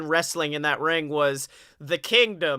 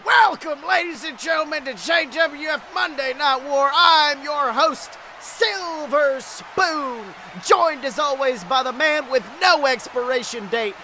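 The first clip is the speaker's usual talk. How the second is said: booming TV wrestling host welcome; wrestling announcer; 8 semitones higher than usual. Acted. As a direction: hype the show open like a ring announcer. Opens a mock wrestling broadcast.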